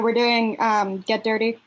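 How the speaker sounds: ordinary talk, nothing marked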